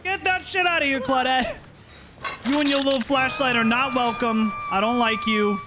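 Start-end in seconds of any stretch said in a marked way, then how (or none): 0.0-1.6 high sassy taunting voice, pitched up; sassy villain scolding the survivor; mock taunt. Killer taunting survivor in game.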